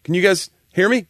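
lightly voiced radio caller. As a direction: imitate an unsure call-in listener checking the line. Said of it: "and they go".

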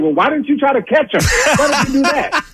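high-pitched, laughing comeback; mock-indignant retelling through laughter; indignant retort. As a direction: throw the question back, pitch jumping up. Acting out his retort to teammate.

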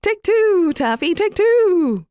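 a daft dove repeating its call; silly dove cry. Acted. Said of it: "the turtle dove cried".

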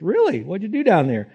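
cheery angel. Acted. Angel asks Jesus in story.

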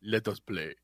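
put-on very low, hushed accented seduction; Antonio Banderas. Host demonstrating his bumblebee voice, Antonio Banderas impression. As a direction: murmur slowly in a deep Spanish-style accent. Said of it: "like a little Antonio Banderas".

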